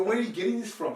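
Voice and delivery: exasperated retold "what on earth"; incredulous. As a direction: react as if the request is absurd. Acted incredulous reply to her request.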